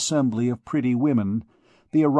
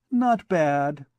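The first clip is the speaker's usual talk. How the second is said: affected disdain; 3 semitones higher than usual. A narrator's reading. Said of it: "with a bland affectation of disdain".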